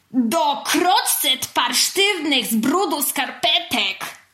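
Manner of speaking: high, slow, emphatic mock curse; cross shout. A narrator's reading. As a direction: spit out each word of a silly swear. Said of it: "Zmarszczyła brwi i zawołała". (gniewne, dobitne zawołanie)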